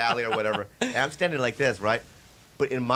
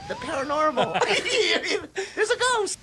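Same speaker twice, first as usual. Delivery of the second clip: laughing mock spooked. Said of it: "So I was like".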